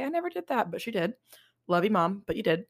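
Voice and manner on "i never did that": high mocking imitation of mom denying it; mocking mom's denial. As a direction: lightly mimic mom protesting innocence. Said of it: "she'll say".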